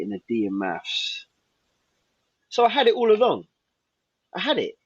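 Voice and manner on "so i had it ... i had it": rising high and loud in triumph; proud burst, I had it all along; high, loud proud exclamation; triumphant. Real, not acted. Triumphant realisation about his grades.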